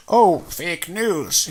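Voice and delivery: put-on slow, drawn-out Trump accent; mock Trump accent, slow and drawn out; Trump impression. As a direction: drag it out like Trump dismissing a story. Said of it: "in his little Trump accent".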